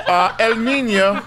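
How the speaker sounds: normal talking voice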